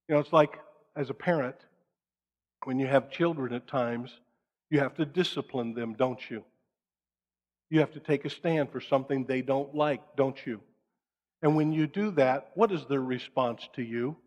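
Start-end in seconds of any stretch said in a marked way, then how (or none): none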